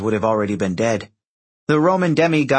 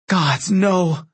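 higher, horrified exclamation; horrified dismay; 7 semitones higher than usual. A narrator's reading. Demigod watching the Senate House explode, high horrified dismay. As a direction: recoil in dread. Annabeth horrified at burning building.

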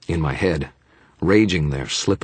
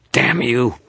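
high, loud furious scream; enraged high shout; screamed curse; 9 semitones higher, 6 dB louder than usual. A narrator's reading. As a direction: scream it in rage. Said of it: "Victor screamed".

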